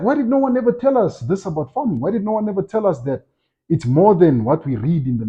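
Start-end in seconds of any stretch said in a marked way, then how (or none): 0.0-3.3 high, exasperated question; exasperated complaint. Retold or imitated. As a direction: retell the friend's outrage. Friend's repeated complaint about farming.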